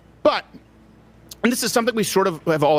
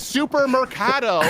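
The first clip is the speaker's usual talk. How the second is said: put-on Spanish accent; 6 semitones higher, slower than usual. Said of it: "really good Spanish accent you got going there".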